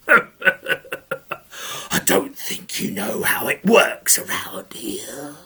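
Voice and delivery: low, slow menacing whisper; ogre whisper. Acted. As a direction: creep it out like a sly ogre. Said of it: "And the ogre says".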